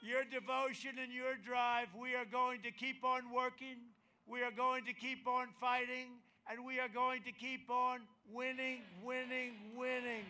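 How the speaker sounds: shouted rally crescendo; rising, chanting rally build; rally speech. Acted.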